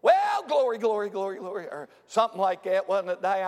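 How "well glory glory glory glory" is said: mock outburst of praise, running the words together; imitating shouting churchwoman. Preacher mimicking Sister at the back of church, praise outburst. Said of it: "I heard somebody back there going".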